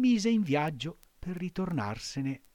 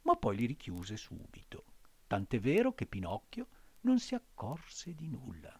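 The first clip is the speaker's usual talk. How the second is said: plain reading voice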